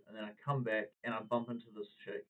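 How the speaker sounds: ordinary delivery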